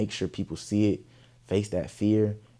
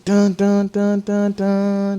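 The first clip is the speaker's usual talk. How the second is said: high, loud vocal beat; beat imitation; 13 semitones higher, 10 dB louder, much slower than usual. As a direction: mimic the drop kicking in.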